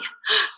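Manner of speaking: sharp high gasp of realisation; surprised gasp. Real, not acted. Realising she named the recruit, surprised gasp. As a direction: catch your breath at the memory. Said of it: "That was actually his name".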